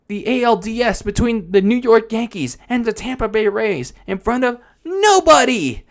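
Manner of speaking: high, worked-up mock lament, building as it goes; panicked Vince. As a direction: wail in exaggerated dismay. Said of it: "Vince sees that".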